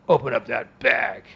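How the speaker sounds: slow, low, gruff order from a burly official; grouchy customs officer. Retold or imitated. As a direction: play a mean big man giving a quiet order. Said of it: "he was a grouchy big man".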